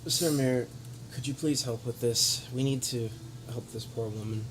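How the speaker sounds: ordinary delivery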